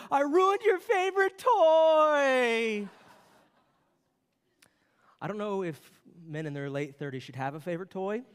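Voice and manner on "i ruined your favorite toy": put-on very slow, high, loud wailing little boy; heartbroken child. Son's last tearful words to dad, high and slow. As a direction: draw out the wail like a devastated kid. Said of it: "And his last words were".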